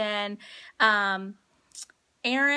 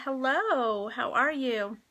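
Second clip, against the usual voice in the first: ordinary talk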